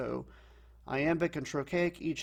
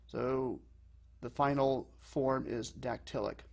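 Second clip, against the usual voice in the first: ordinary talk, nothing marked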